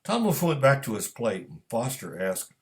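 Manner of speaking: usual speaking voice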